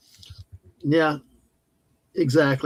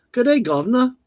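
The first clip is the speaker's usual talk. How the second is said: a hammed-up British accent, pitched higher; 8 semitones higher than usual. Cohost greets chat in a mock British accent. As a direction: play a cartoon English gent in a British accent. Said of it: "please don't do the accent".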